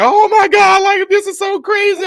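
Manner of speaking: high, loud fake excitement to play it off; faked amazement. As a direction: gush in a high put-on voice to cover up. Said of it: "tried to play off like".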